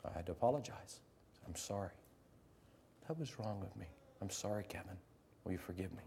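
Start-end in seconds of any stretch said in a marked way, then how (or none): 1.5-6.0 much softer, sincere and low; soft apology. Retold or imitated. Teacher to Kevin, soft sincere apology. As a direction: quietly ask forgiveness.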